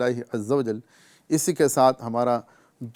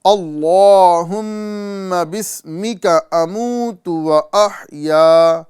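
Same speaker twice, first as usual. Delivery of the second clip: very slow, higher, louder chanted "اللہم بسمک اموت و احیا"; slow recitation; 3 semitones higher, 6 dB louder, much slower than usual. Shown as a demonstration. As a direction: recite each syllable stretched for students to copy. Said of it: "مکمل دیکھتے ہیں".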